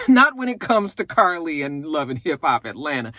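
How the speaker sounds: normal talking voice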